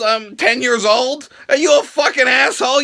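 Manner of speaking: ordinary talk, nothing marked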